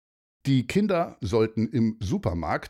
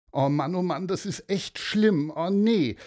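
put-on higher girl's voice, lamenting; dismayed girl; 8 semitones higher than usual. Leni despairs on the beach with her brother. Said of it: "rief Leni".

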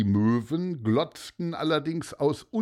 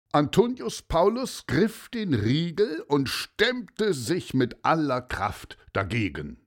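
usual speaking voice

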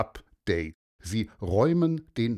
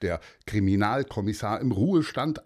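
usual speaking voice

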